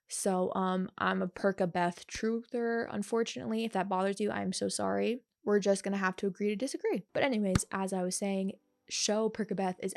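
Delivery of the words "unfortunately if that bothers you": ordinary talk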